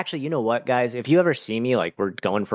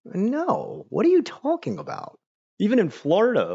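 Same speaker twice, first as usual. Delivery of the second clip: very high, squeaky disbelief; mock appalled, pitched way up; incredulous denial; 13 semitones higher than usual. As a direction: retell it as a high-pitched baffled protest. Retold incredulous denial about guns.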